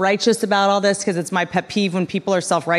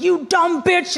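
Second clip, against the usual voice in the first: mock yell; 8 semitones higher, slower than usual. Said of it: "this guy yells at me".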